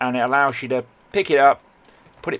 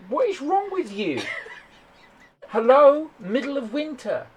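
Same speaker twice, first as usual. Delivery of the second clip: mock disbelief; 12 semitones higher than usual. Mock disbelief at shorts in winter.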